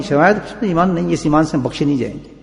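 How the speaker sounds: ordinary delivery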